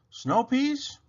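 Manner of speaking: high, hopeful bunny question; Rabbit voice. Acted. Rabbit offering Robot snow peas, high bunny voice. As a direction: pipe it up like a small rabbit.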